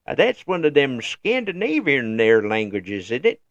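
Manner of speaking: lazy mock Midwestern American, dragged out; mimicking the average Midwest American guessing at Scandinavian languages, lightly drawled; Midwest yokel. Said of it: "Joe average Midwest United States person".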